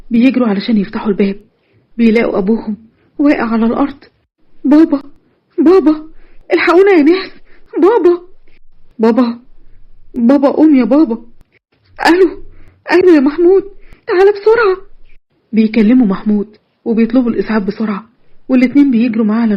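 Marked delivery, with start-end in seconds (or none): 4.6-14.8 high frantic cries for dad; screaming for help in terror; panicked calling. Acted. الأب واقع على الأرض. (نداء مذعور بصوت عالٍ)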